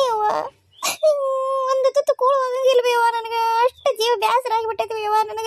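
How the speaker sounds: high, sobbing folk keening; wailing lament. Acted. Mother and daughter weep over her hardship, wailing high. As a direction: wail it, voice breaking.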